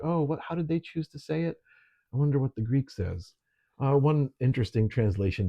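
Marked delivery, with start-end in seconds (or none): 0.0-3.3 higher-pitched voice of an intrigued reader; curious wondering. Acted. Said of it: "'cause you're like".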